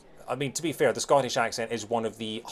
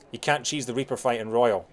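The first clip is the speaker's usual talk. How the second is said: ordinary talk, nothing marked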